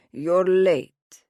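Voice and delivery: the let-down dad greeting her gruffly; disappointed grumble. A narrator's reading. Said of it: "a disappointed voice grumbles".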